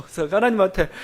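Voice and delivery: ordinary talk, nothing marked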